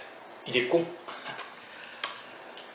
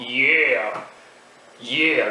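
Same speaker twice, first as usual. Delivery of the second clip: drawn-out American "yeah", falling; exaggerated American accent demo. Shown as a demonstration. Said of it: "L'accent américain, c'est un peu comme ça".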